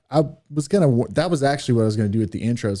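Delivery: normal talking voice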